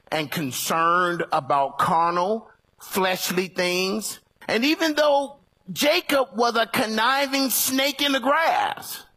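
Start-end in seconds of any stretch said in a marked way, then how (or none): 4.5-9.0 rising preacher's cadence, pitched up; preaching. Acted. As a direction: preach it with building fervor. Preaching rises.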